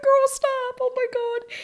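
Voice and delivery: high, squeaky plea on the edge of tears; tearful squeal. Real, not acted. Viewer overwhelmed by the sweet moment, high tearful squeal. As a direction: squeak it out, overcome and moved. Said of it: "Can't believe I'm crying".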